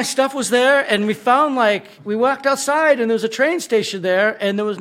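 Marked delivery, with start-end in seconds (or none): none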